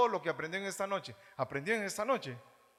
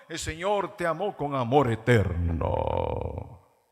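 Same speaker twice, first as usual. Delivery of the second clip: slow, louder, lower pompous preaching; impostured preacher voice; 5 semitones lower, 9 dB louder, slower than usual. Shown as a demonstration. Pastor mocking a TV preacher, slow booming lower delivery. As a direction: intone it grandly like a fake pastor. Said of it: "toda impostada la voz".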